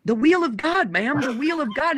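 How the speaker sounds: high, stretched Southern preacher saying wheel for will; Southern drawl, the wheel of God, ma'am; dad's Southern accent. Retold or imitated. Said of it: "I know my dad's accent".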